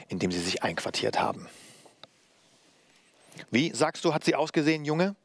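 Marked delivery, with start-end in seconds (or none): 3.5-5.1 raised-pitch, urgent questioning; agitated. A narrator's reading. Master questions boy about the puppeteer, agitated. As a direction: press the boy anxiously for an answer. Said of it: "Mein Meister lief aufgebracht".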